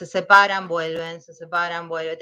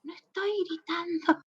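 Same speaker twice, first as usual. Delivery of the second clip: high, hushed whisper; strained high whisper; retold whispered protest; 9 semitones higher, 11 dB quieter than usual. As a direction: whisper it thinly, insisting you are not shouting. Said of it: "y yo le decía".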